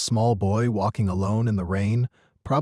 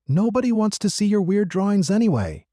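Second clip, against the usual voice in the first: higher-pitched mocking taunt; jeering bully; 9 semitones higher than usual. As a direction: taunt him like a schoolyard bully. Bully jeers at a smaller boy.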